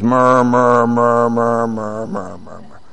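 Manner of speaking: low droning mumble, fading away; murmuring. Shown as a demonstration. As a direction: imitate grumblers muttering under their breath. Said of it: "It kinda means like it sounds".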